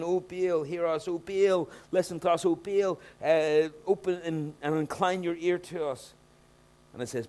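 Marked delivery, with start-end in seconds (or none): 0.0-6.2 loud, higher wailing plea to Baal; mimicking the prophets of Baal begging; frantic pleading. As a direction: cry out desperately, over and over. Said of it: "they kept saying over and over again".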